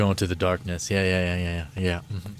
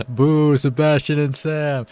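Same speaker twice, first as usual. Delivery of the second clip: higher, louder mock jeer; playful boo; 5 semitones higher, 5 dB louder than usual. Real, not acted. Gamer jokingly booing the dead companions. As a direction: tease the fallen pair.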